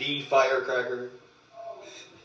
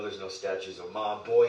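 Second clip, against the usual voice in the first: usual speaking voice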